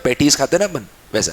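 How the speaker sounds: usual speaking voice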